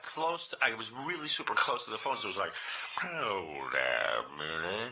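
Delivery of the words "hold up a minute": low, very slow drawl; the Dwayne voice. Pretend. Fake caller Dwayne stalling close to the phone, low and slow. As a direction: drag it out low. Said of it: "So I got the voice".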